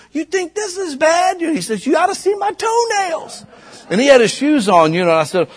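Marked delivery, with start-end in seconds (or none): 0.1-3.5 much higher, shouted mock voice; high, loud put-on voice, toenails boast; old man's retort. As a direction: bellow it like the odd old man bragging. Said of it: "he goes".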